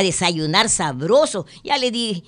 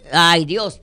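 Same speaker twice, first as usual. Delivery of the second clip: normal talking voice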